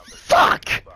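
loud voiceless curse spat out; sharp angry yell; angry shout. Real, not acted. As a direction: let the frustration burst out. Fails the kill.

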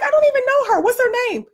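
imitating clueless gym instructors, high and incredulous; baffled instructors. Imagined instructors baffled by letter.

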